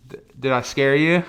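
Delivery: gleeful after the scare; loud and excited. Real, not acted. Right after jumping out.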